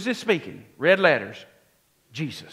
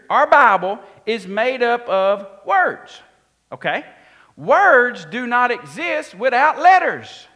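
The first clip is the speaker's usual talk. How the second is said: raised pulpit voice, stretching the key words; emphatic preaching; 5 semitones higher, 9 dB louder than usual. Real, not acted. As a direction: drive the point home to the congregation. Preaches the point to congregation.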